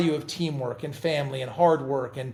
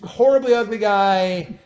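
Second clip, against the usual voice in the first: mock insult; 5 semitones higher, 8 dB louder than usual. Ironic joke about Hemsworth.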